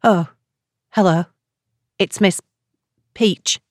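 hesitant caller with pauses between words; shaky, halting greeting. A narrator's reading. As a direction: falter through a nervous introduction. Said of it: "The woman's voice sounded shaky".

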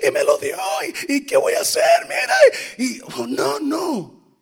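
loud, high hammed-up sobbing drunk; wailing over the lost girlfriend, imitated; mock drunken crying. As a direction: bawl it like a jilted drunk. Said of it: "él bien borracho".

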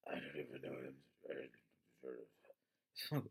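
low, soft, mumbled Batman growl; mumbling Batman. Retold or imitated. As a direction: sink the words into a low murmur you can barely follow. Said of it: "I don't even know what your man's fucking saying".

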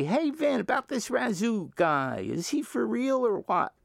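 a nosy stranger half-teasing; curious stranger. Retold or imitated. Stranger at the supermarket quizzing the DJ, lightly mock voice. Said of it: "people come up to me and say".

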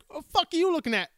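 mock mugshot snarl. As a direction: snap it like a drunk thug glaring at the camera. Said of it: "The first guy looks like".

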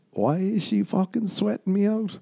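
ordinary delivery

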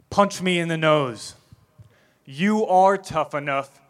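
loud, firm encouragement; rally the scared boy, loudly but kind; loving coaching shout. Retold or imitated. Mother urging her crying son to hit her, loud and firm.

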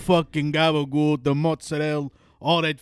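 loud slow New York Italian mock accent. Mocking New York Italians for friends, loud Italian accent. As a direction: ham up the Jersey Italian "gabagool". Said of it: "that's how they talk".

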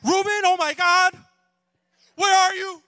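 very high, very loud panicked shriek; apocalypse mom. Retold or imitated. Mother phones her son, apocalypse-level panic. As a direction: shriek as if the world is ending, hammed-up mom. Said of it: "or it's apocalypse mom".

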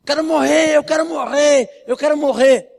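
high, loud desperate cry; imitating a yelled wish to die; retold screaming. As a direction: cry it out like a man begging to die. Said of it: "o Graciano começou a gritar".

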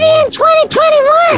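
high squeaky voice. Pretend. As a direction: squeak it out teasingly. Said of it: "repeated with high voice".

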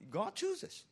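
a high put-on tag; mock emphatic. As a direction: squeak the final word. Moses' challenge climax.

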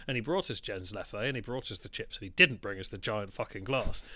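ordinary talk, nothing marked

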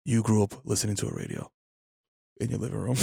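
low, soft, measured jab; deadpan put-down. Real, not acted. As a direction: drop right down and let it land. Dryly mocking co-host's age after argument.

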